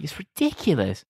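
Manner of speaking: ordinary delivery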